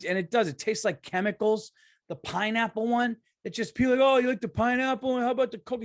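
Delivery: getting mad. Real, not acted. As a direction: rant like it's a real grievance, voice up. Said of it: "I don't know why I'm getting mad".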